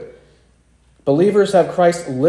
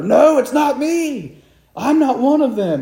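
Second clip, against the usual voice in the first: yelling denial; 11 semitones higher than usual. Retold or imitated. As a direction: shout him off as a frightened man lying. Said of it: "he yells at her".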